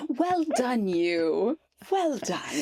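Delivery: mock British cheer. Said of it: "mocking the British app voice".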